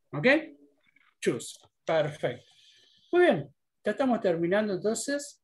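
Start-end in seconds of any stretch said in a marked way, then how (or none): 1.2-1.6 juice said low as one syllable, "joos"; silent I. Shown as a demonstration. Teacher confirming the correct juice, one-syllable choose. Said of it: "la I es muda acá".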